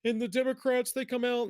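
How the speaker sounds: hammed-up high whimpering plea; a whiny, teary caller played for laughs; mock crying caller. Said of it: "the guy's, like, crying".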